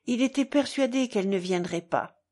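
ordinary delivery

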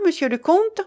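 high, rising question; questioning prompt. A narrator's reading. Guest turns to the count for his answer, questioning. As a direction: prod him for an answer, lifting the end.

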